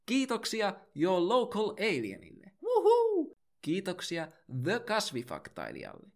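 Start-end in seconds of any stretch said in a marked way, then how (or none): 2.6-3.4 very high, loud falling wuhuu cheer; whoop. Acted. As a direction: cheer like a fan at a concert. Cheer after each patron thanked.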